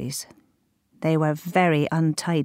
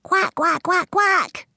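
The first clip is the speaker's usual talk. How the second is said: mummy duck quacking; 14 semitones higher, slower than usual. A narrator's reading. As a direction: quack like a mother duck calling her young. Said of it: "took a deep breath and said".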